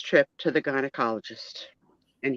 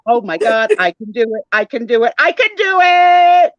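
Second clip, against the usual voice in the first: building self-pep yell; 5 semitones higher, 8 dB louder than usual. Acted. Jody psyching herself up for doctor, building shout. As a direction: pump yourself up until you shout.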